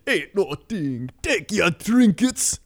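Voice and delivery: high, slow, lilting troll impression; troll voice line. Friend imitates a Pandaria troll, high and slow. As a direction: stretch it out like the game troll. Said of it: "he's like".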